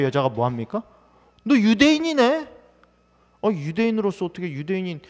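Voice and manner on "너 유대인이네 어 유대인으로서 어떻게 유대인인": surprised retort. Acted. Samaritan woman retorts to Jesus.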